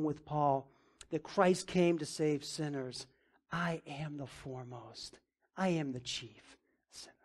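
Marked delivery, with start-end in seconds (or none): 3.5-7.1 quiet confession. Retold or imitated.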